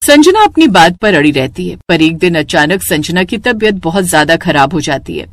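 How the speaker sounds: ordinary delivery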